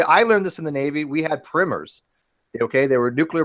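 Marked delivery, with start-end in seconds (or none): 1.4-2.0 "primers" with a short i, like "primmers"; short-i pronunciation. Real, not acted. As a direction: say "primers" to rhyme with swimmers. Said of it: "How you guys pronounce this word?".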